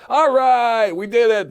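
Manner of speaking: high, loud cheer to close the show; excited wrap-up. Real, not acted. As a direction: celebrate finishing the episode.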